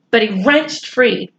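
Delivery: ordinary delivery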